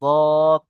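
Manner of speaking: দদ with the vowel held long; দদ firmly projected and stretched for learners; letter-sound demo. Shown as a demonstration. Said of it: "সাত নম্বর মাখরাজটি দদের মাখরাজ".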